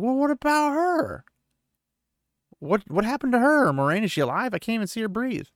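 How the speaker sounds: high, urgent worried questions; anxious alarm. A narrator's reading. Perrin panicking about injured girl.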